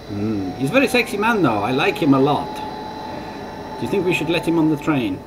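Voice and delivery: high, swooning mock French lady; terrible French accent. French lady swoons over the driver, high mock French accent. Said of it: "my terrible French accent".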